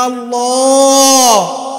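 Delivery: slow, loud, crying Allah; tearful call. Shown as a demonstration. Preacher showing the crowd how to call on God, weeping. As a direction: let the name break into a cry. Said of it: "বলেন না".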